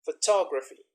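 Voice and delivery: "photography" punched on "tog"; stress on second syllable. Shown as a demonstration. Teacher showing stress shift to students, second-syllable stress. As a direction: hit the second syllable of "photography", fall after it. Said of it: "second, and the third syllable in different words".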